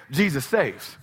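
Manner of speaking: low, settled answer that closes debate; unmoved reply. Shown as a demonstration. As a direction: answer the objector calmly, dropping the pitch. Calm stock answer to arguer.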